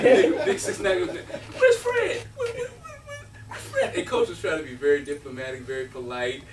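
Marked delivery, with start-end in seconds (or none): 1.1-3.9 very high, comic stammering call; big guy's goofy holler. Retold or imitated. Big six nine demands Fred from coach, high-pitched mock holler. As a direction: mimic a big guy squawking for his friend. Big guy voiced, repeating himself.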